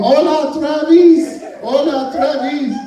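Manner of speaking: loud, high happy hollering; excited group hello; cheerful crowd greeting. Retold or imitated. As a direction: shout hello like a crowd waving. Fifty people waving greeting.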